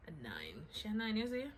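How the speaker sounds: ordinary delivery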